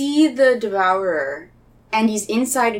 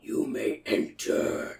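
slow, eerie whispered beast; monster whisper; 12 semitones lower, 7 dB quieter, slower than usual. Acted. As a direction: hiss it out from the dark hollow. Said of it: "Maybe he's like".

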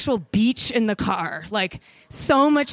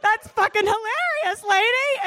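high, laughing no way; laughing disbelief; 11 semitones higher than usual. Retold or imitated. Telling the clerk, laughing high. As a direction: crack up at the news. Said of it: "that was my exact reaction".

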